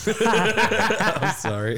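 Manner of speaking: high laughing apology. Real, not acted.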